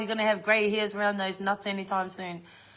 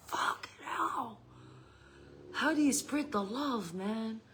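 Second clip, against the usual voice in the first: whispered, slow and awed, as if stunned; mock whisper; 2 semitones higher, 7 dB quieter, slower than usual. Reacting to sixteen grandchildren.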